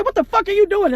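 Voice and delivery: high, loud startled yell; hammed-up yell of disbelief; mock outraged yell. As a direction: snap at him in shock. Partner yelling at him mid-act.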